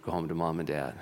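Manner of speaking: low, soft, gentle release; tender voice freeing the girl. A narrator's reading. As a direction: speak to her like a kind father. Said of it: "and said".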